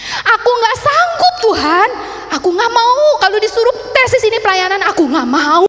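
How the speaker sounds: high, loud mock wail; tearful refusal. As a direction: reenact a desperate refusal to God. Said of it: "kenapa saya nangis? Saya nggak rela".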